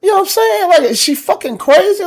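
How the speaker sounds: high, loud outraged rant; outraged disbelief. Retold or imitated. About the neighbor dumping litter on the car, high outraged yell. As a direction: blow up at how crazy this is. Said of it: "So now I'm like".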